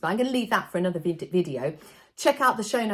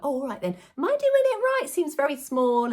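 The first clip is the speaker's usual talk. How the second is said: higher, mock-clueless questioning; playing dumb; 7 semitones higher than usual. Entertainer acting confused in front of the children, higher and mock-clueless. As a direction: ham up being confused for the kids. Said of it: "I act a little bit dumb".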